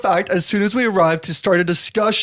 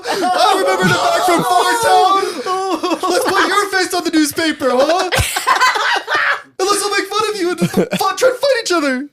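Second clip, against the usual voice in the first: mocking laughing rant; 7 semitones higher than usual. Real, not acted. Mock quarrel between players.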